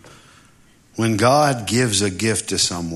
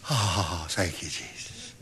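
relieved whisper; 7 semitones lower, 11 dB quieter than usual. Acted. Relieved prayer after rescue.

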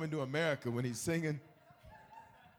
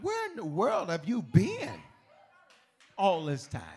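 louder, higher, slow amazement; amazed onlookers; 8 semitones higher, 11 dB louder, slower than usual. Retold or imitated. People stunned when you show up, louder. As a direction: gasp it out like they can't believe it. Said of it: "they're gonna be like".

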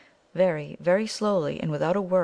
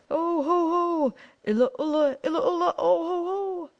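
fearful shout; 13 semitones higher than usual. A narrator's reading. Thumb halts his brothers on the cliff path, fearful shout. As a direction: call out high in alarm. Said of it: "shouting in a voice of fear".

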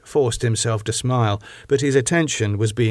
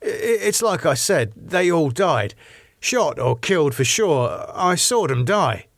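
higher-pitched, insistent character voice; insistent David; 6 semitones higher than usual. A narrator's reading. David reassuring Elizabeth they all died, insistent. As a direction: insist on a painful memory, pitched up.

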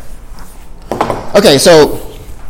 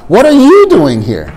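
"What are you doing here?" slow, with "you" raised; stress on you, slow; 3 semitones higher than usual. Shown as a demonstration. Teacher repeating the model line slowly for the class, stress on you. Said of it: "one more time".

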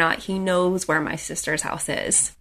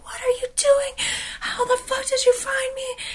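screaming. Retold or imitated. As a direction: squeal it like a held-back scream. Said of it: "I started screaming".

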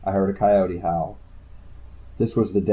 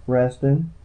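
plain reading voice

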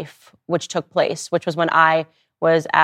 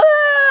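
sudden very high, loud "ah" cry; hammed-up crying outburst; mock hysterical wail; 6 dB louder than usual. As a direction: burst into an acted wail out of nowhere. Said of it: "start crying hysterically".

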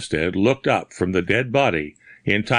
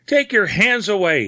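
high, desperate plea; panting panic; 11 semitones higher, 3 dB louder than usual. A narrator's reading. Bearded man clutched at the throat, panting panic. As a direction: fight off the choking hands in panic. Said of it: "he panted".

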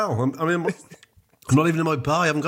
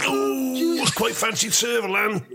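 camp swoon; 9 semitones higher than usual. Pretend.

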